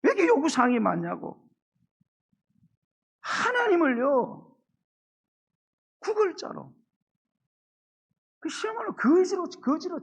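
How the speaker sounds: loud, high, fervent sermon delivery; full-force preaching. Acted. (목소리를 높여 힘차게 설교하듯)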